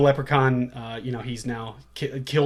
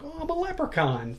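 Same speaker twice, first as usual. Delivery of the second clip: high, sing-song leprechaun voice; whimsical leprechaun. Retold or imitated. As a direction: play a cute little leprechaun. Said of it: "he's very, like".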